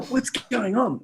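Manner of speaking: high-pitched baffled question; imitating confused staff; puzzled workers. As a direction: ask it bewildered, pitch lifted. Acting puzzled workers searching.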